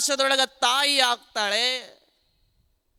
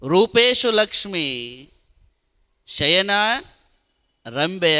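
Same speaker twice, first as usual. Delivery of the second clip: slow, measured Sanskrit verse recital; verse recitation; 2 semitones lower, slower than usual. Acted. Speaker quoting a verse on a wife's roles, slow recitation. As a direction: draw out each phrase of the verse, with pauses between.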